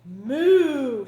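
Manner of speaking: loud, drawn-out moo; big cartoon cow moo. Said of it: "said Cow quickly".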